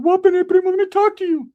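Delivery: high, loud cartoonish cousin voice; cousin Ricky voice. Cousin Ricky pulling him aside, in a high squeaky voice.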